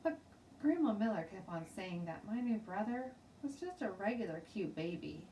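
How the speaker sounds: plain reading voice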